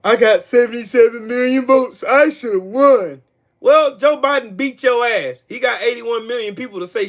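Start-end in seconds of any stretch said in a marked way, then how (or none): 0.0-3.2 higher, fake-crying complaint; mock whining loser. Streamer mimicking the Cheeto man losing, mock crying whine. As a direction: whine it like a hammed-up sore loser. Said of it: "much like the Cheeto man himself, they can't take a L".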